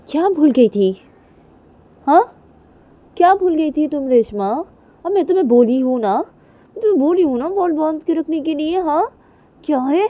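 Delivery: loud, high-pitched telling-off; angry scolding. Acted. Kajal scolds Reshma over untied hair, angry shouting. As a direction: raise your voice and demand an answer. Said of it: "इतना चिल्ला क्यों रही हो".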